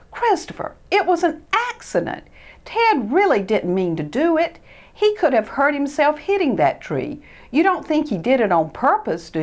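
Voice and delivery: calm him down; gentle Freddie. Acted. Freddie calming Christopher about the accident.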